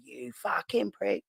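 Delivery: high, drawn-out mock scolding, kept soft; mimicked angry wife. Wife on a voice note to her husband, imitated scolding. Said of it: "she's fucking voice noted him going".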